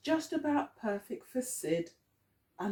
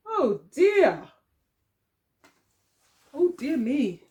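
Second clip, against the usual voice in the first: mock dismay; 4 semitones higher, 5 dB louder, slower than usual. A narrator's reading.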